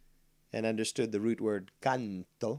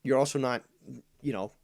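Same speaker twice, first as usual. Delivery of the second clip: usual speaking voice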